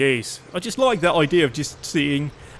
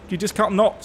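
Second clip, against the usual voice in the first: ordinary delivery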